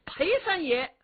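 ordinary delivery

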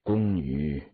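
slow, low, regretful admission; heavy, drawn-out imperial sigh. A narrator's reading. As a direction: admit fault ponderously, dropping well down in pitch. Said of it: "雍正低头喀叹道".

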